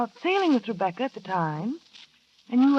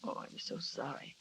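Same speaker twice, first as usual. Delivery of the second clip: hushed shock; 10 semitones lower, 20 dB quieter than usual. Acted. Shocked news of the boat.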